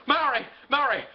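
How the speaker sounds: eager acted holler; calling out. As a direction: call through the house for your wife. George calling for his wife.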